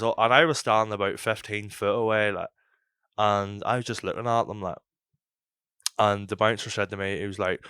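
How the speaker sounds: ordinary talk, nothing marked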